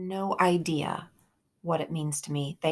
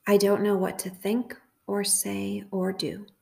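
slow, measured prayer with long pauses; guided meditation prayer. Real, not acted. Host leading listeners through a prayer for confusion, measured meditation. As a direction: lead a meditation phrase by phrase, leaving room to repeat.

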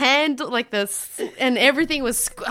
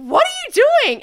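put-on high, louder baffled drunk; drunk man; 13 semitones higher, 4 dB louder, faster than usual. Drunk husband stares at her, high baffled shout. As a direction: gawp in drunken disbelief. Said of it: "And it's like".

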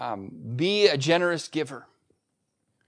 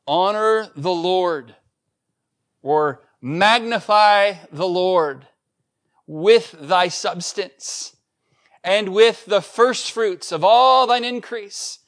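slow, loud and higher, each word weighed; slow scripture reading; 3 semitones higher, 5 dB louder, slower than usual. Acted. Pastor reading the tithing verse to the church, slow and weighty.

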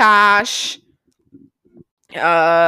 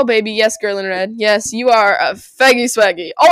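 ordinary talk

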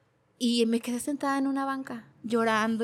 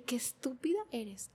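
quiet self-scolding; 12 dB quieter than usual. Retold or imitated. Retold self-insult.

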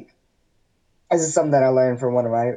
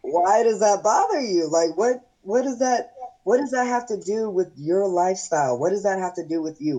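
high-pitched, incredulous retold questioning; exasperated; 7 semitones higher than usual. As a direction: demand it as if it makes no sense. Second, exasperated take of retold question.

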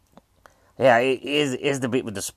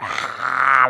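slow, drawn-out mock ghost moan; 5 dB louder than usual. Explaining the film's ghost to viewers, slow mock moan. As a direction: imitate the half-faced ghost groaning. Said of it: "like that".

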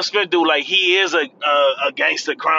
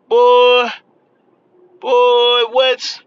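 stunned outcry; 5 semitones higher, 5 dB louder, much slower than usual. Real, not acted. Reacting to the star's breakup news, loud and stunned. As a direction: blurt it out in loud shock.

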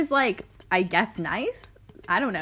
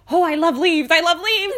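lightly done cutesy horse voice, higher and louder; 8 semitones higher, 7 dB louder than usual. Retold or imitated. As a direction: imitate the guide voicing her horse. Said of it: "She just had little voices in her head for each horse".

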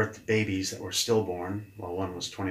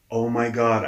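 ordinary talk, nothing marked

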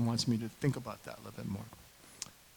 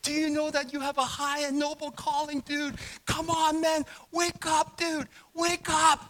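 high, loud urgent pleading; reenacted preacher's exhortation at full force; in-his-face preaching; 13 semitones higher, 5 dB louder, slower than usual. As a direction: shout to rouse an oblivious friend. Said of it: "went right into his face".

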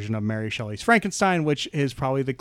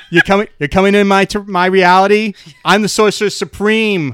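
put-on loud, higher, blustering Boston accent; Southie Boston accent; 5 semitones higher, 8 dB louder than usual. Doctor Strange confronting an intruder in his reality, loud Southie accent. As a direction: challenge an intruder in broad Southie. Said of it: "I want the Southie Boston Doctor Strange".

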